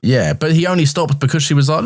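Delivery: ordinary delivery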